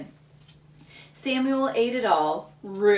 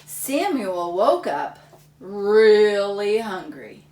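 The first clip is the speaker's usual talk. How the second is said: slow dramatic reading; 8 dB louder, slower than usual. A narrator's reading. Dramatic read-aloud to children.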